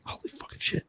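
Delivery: breathy whispered awe; whispered amazement. Retold or imitated. Officers seeing the sketch match, whispering in awe. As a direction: whisper it like they can't believe it.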